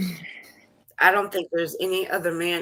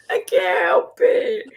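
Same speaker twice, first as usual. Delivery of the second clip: high, drawn-out cracking wail; slow, squealed helpless protest; overcome; 13 semitones higher, 4 dB louder, slower than usual. Real, not acted. Cohost who can't help it, overcome and wailing.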